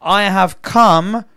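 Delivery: rising full-force sermon voice; fervent preaching. Acted. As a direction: drive each word home to the congregation. Preaching Jesus' words at full force.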